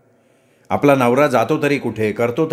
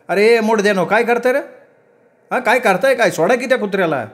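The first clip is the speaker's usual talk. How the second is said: high-pitched scolding yell, only slightly louder; 9 semitones higher than usual. A narrator's reading. Mother yelling at men grabbing her dog, high-pitched. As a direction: yell at them in a much higher voice. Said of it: "मोठ्यानं ओरडल्या".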